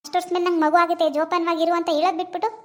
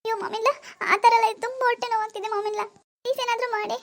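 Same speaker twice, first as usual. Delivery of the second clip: high, strained voice crying out in pain; moaning in pain, pleading with her mother-in-law; 6 semitones higher than usual. Acted. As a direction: beg for help through stomach cramps. Acted stomach pain, begging help.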